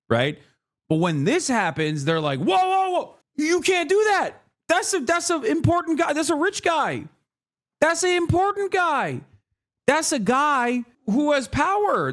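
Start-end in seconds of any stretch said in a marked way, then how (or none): 2.4-12.1 high, alarmed mocking voice of the powerful; high mock outrage on behalf of the rich; mock panicked elite. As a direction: squeal like the outraged establishment.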